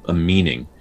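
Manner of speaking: ordinary talk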